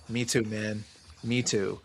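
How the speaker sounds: soft, low, resigned reply; subdued agreement. Retold or imitated. Commiserating with a fan holding a sign, subdued. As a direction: drop it low and quiet. Retells sad exchange with fan.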